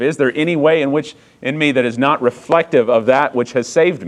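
high, loud, driving preacher's cry; climbing to a fervent sermon peak; preaching at full force. Acted. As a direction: preach it out to the whole room. Preaching rises again.

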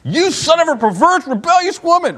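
teacher replaying a king's insult with mock shock; mock scandalized cussing. Shown as a demonstration. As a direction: read it like an outrageous curse you can't believe. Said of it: "I'm pretty sure Saul just did".

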